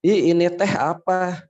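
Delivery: put-on Sundanese accent. Sundanese speaker asking a Javanese person, Sundanese accent. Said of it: "saya orang Sunda nih, ngomong ke orang Jawa".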